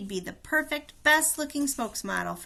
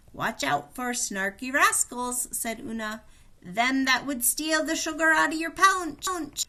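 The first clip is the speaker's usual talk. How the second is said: put-on higher, louder old granny warning; old Irish woman; 5 semitones higher, 5 dB louder than usual. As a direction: warn her like a fussy old great-grandmother. Great-grandmother Una warns Haley.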